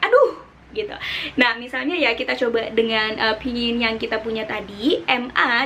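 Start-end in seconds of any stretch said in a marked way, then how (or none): 0.0-0.4 much higher, sharp falling aduh; startled aduh. Shown as a demonstration. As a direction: blurt aduh in surprise. (aduh kaget, nada turun tajam)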